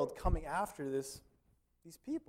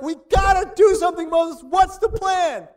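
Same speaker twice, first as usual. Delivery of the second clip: much higher, very loud frantic cry, dragging out "What's the plan?"; freaking out; 18 dB louder, slower than usual. Retold or imitated. Israelites cornered by the sea cry to Moses, frantic and very loud. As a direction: shriek it like people trapped by an army. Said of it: "and they're like".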